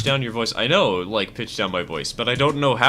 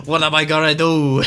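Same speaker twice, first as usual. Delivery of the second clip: hammed-up New York gangster pleading, louder; mock mobster begging his pal, louder and faster at times; 5 semitones higher, 7 dB louder than usual. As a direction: beg Tony like a panicked wiseguy. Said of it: "stereotypical New York mobster".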